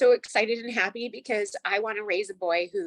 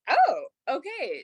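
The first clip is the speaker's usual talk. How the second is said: slow, higher drawn-out surprise; pleasantly surprised; 8 semitones higher, slower than usual. Retold or imitated. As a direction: let the surprise sink in slowly. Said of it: "And I was just like".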